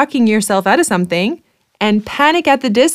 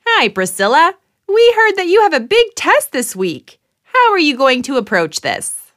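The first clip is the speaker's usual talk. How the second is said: game show host; 6 semitones higher than usual. Acted.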